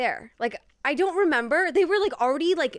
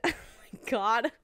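normal talking voice

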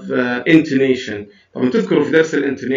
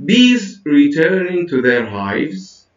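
very slow, "Bees" pitched high and "hives" lifted at the end; drawn-out teacher demo, "hives" left up in the air; list intonation; 3 semitones higher, much slower than usual. Shown as a demonstration. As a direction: model the list tune, rising on "hives". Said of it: "notice the intonation".

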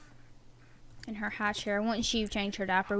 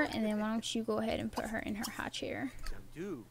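whispering; 8 dB quieter than usual. Real, not acted. Trying not to wake others.

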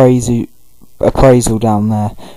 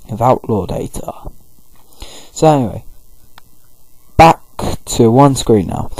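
ordinary talk

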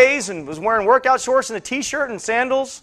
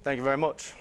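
low, quiet mumbled catchphrase; low-voiced rock-and-roll star thanks; 7 semitones lower, 10 dB quieter than usual. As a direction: toss off a soft, deep impression of the singer. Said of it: "[name]'s house".

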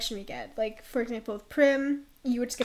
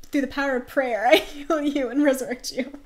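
grand, louder mock preacher, laughing midway; mock faith healer; 3 semitones higher, 10 dB louder than usual. Mocking Ophelia's prayer healing, in a loud pious voice. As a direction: ham up a holy healer. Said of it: "who's like".

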